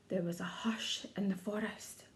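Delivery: whispered, breathless hush. A narrator's reading. Narrator telling the hushed forest, whispering. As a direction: breathe it like a secret.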